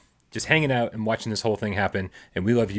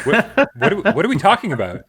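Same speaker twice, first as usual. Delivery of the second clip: baffled; 8 semitones higher, 6 dB louder than usual. Real, not acted. Confused by the apology.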